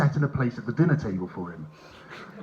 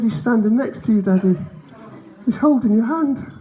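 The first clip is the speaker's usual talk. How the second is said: put-on little boy; 8 semitones higher than usual. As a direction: break it to Daddy like a small son. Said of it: "He said".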